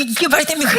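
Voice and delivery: high, whiny protest to God; mock complaint. Preacher voicing a complaining missionary, high mock whine.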